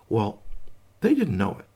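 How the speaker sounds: ordinary delivery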